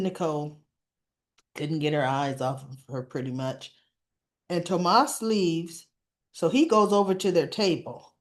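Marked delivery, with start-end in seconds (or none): none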